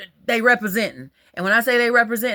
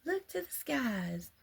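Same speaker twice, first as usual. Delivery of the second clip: put-on quoting the girl; 6 semitones higher, 8 dB quieter than usual. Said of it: "the girl was like".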